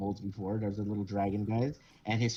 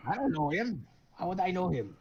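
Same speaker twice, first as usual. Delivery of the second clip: a little higher, flustered denial; lightly voiced old man; 7 semitones higher than usual. A hint of old man disowning the kobold, flustered. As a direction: back away from him. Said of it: "old man denying the kobold".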